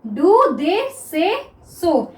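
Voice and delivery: each word drawn out slowly for students; very slow classroom dictation; slow dictation read. Shown as a demonstration. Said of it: "तो क्या हो गया".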